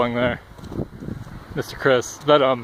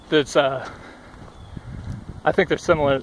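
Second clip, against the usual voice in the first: winded, talking between breaths. Real, not acted. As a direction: catch your breath while talking. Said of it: "I'm super fucking winded".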